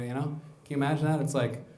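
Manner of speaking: ordinary talk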